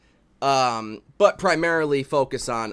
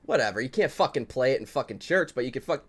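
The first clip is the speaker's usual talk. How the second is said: ordinary talk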